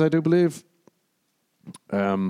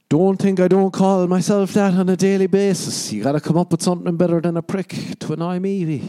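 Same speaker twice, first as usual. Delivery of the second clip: high, loud comic retort; mock defiance; 9 semitones higher, 5 dB louder than usual. Man answering a drunk who insulted him, mock-defiant. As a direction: brush off the insult in a raised, lilting voice.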